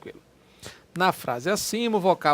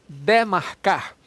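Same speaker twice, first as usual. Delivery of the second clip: drawn out, a touch louder; slow demo word; 3 dB louder, much slower than usual. Shown as a demonstration. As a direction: lay out the word slowly for students. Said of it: "teacher shows word". (professor pronuncia devagar para a turma)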